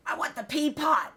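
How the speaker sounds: high, loud bellowed demand; cranky patient shouting out; retold yelling. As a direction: holler it insistently, mimicking a sick father. Repeats father's yelled demand.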